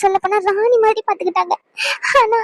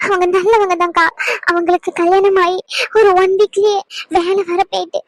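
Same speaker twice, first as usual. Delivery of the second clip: ordinary talk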